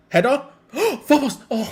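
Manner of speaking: loud, high gasping call; excited gasp. Real, not acted. Fan reacting live to a header at the far post, gasping.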